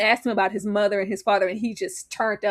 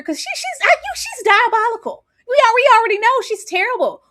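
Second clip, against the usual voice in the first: much higher, louder, laughing outburst; outraged laughing rant; 12 semitones higher, 6 dB louder than usual. Real, not acted. Co-host on a terrible TV mother, laughing outrage. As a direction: exclaim it through laughter, pitched way up. Ranting about a diabolical mother.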